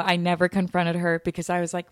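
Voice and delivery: ordinary delivery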